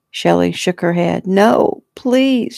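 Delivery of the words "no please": high, pleading whimper. A narrator's reading. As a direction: whimper it helplessly. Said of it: "she whimpered".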